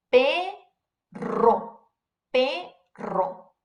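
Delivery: "perro" with a long rolled RR; strong double R. Shown as a demonstration. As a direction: trill the RR in perro, syllables held apart. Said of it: "so our example word is".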